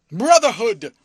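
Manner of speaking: a loud, ferocious bark of one word. A narrator's reading. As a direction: hurl it at him. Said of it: "in a ferocious voice".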